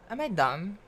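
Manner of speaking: normal talking voice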